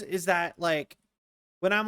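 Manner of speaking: ordinary talk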